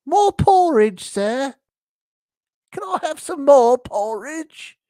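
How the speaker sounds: high, loud falsetto begging; pleading little orphan. Acted. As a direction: ask in a squeaky meek voice. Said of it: "it's asking for more".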